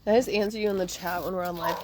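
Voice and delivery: normal talking voice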